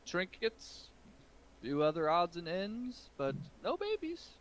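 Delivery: put-on the old merchant feigning innocence to the ogre; flustered shopkeeper. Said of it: "he says".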